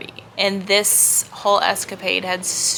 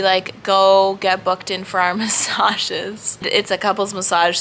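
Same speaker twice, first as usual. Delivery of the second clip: ordinary delivery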